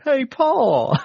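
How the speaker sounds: imitating a bad Southern accent. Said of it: "those very iconic Southern accents".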